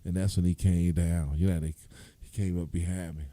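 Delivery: ordinary talk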